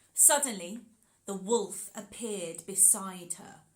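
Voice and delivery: ordinary delivery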